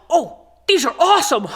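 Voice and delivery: high, gasping mock amazement; imitated delight. Classmate realizes the sticks are pencils, mock delight. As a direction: act a kid suddenly thrilled, ending in a laugh.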